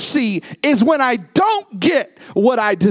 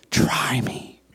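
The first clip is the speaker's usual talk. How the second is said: hushed, breathy whisper of God's dare; 7 semitones lower, 4 dB quieter than usual. Acted. Preacher voicing God to his congregation, whispered. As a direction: lean in and whisper the challenge.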